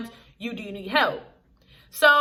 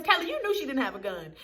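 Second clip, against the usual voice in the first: ordinary talk, nothing marked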